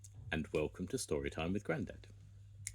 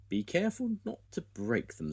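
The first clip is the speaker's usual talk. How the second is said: high character voice; 10 semitones higher than usual. Acted. Farmer Pickles voiced.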